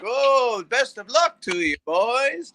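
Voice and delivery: high, polite Minnesota singsong; very high Minnesotan lilt; mock Minnesota accent. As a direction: imitate nice Minnesota fans wishing luck. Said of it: "that accent".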